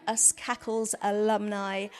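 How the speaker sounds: normal talking voice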